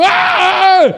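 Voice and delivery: high, loud panicked yell; terrified scream. Retold or imitated. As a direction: shriek in fright.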